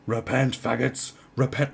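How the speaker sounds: mock hellfire street shouting; megaphone street preacher. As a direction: imitate a ranting preacher on a megaphone. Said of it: "people with their megaphones going".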